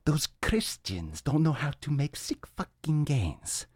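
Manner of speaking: mock Viking bravado. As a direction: boast like a warrior from the show Vikings. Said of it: "like, the show Vikings right now".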